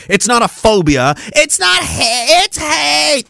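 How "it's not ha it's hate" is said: high, put-on whiny protest; high sneering mock voice; mocking shrill voice. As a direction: parody the accuser crying hate. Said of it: "mocking opponents".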